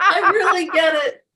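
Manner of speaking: high, loud, laughing as she says it; mock earnest reply cracking into laughter; laughing through it. Coach imitating herself to her class, laughing. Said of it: "I'm like".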